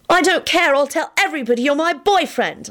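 put-on high, defiant girl's voice; stubborn high-pitched insistence; obdurate. A narrator's reading. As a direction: dig your heels in. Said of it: "Elizabeth replied obdurately".

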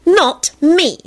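high, emphatic refusal; defiant. A narrator's reading. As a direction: proclaim it defiantly. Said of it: "Solange proclaimed defiantly".

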